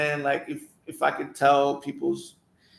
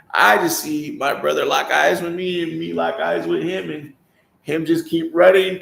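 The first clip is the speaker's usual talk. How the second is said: ordinary delivery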